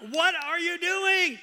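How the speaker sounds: high, slow, drawn-out exasperated yell; very loud. Retold or imitated. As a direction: shout each word slowly at them. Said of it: "And I'm being very loud".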